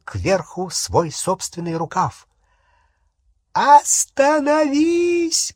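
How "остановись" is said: very high, drawn-out shriek; loud, desperate command; piercing scream. A narrator's reading. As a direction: scream to stop them at once. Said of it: "пронзительно крикнула Беллатриса".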